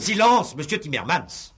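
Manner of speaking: crié très haut et très fort; ordre crié. Acted. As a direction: faire taire l'accusé d'un cri. Juge fait taire l'accusé. (cri d'autorité du juge)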